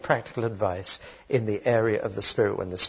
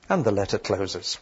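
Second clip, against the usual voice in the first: ordinary delivery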